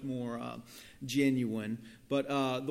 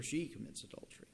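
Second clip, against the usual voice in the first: whisper; 2 semitones higher, 6 dB quieter, much faster than usual. Real, not acted. Whispered aside before reading.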